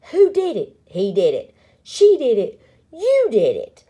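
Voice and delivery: loud, punched accusations with falling ends; accusing. A narrator's reading. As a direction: fling the blame loudly from one to another. Prairie dogs accuse one another.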